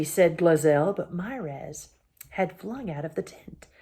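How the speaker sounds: usual speaking voice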